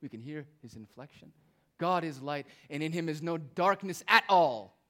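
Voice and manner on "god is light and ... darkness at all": God is light and in him is no darkness at all, climbing louder to a strong "at all"; raised voice. Shown as a demonstration. Preacher voicing John nearly raising his voice. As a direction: nearly shout the promise. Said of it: "John's almost raising his voice".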